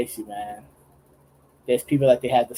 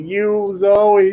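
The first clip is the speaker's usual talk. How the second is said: imitating a character; 8 semitones higher, 3 dB louder than usual. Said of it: "asking him, like".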